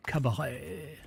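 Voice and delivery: "Cabaret" with a drawn-out French accent. Shown as a demonstration. Streamer showing how "Cabaret" has to be said, French accent. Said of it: "say it with a French accent".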